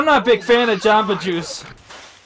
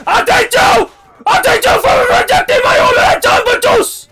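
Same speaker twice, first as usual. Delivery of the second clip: very high, full-volume screaming rant; enraged shouting; 14 semitones higher, 10 dB louder than usual. Pretend. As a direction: scream the challenge at the top of your voice. Enraged father challenges the group.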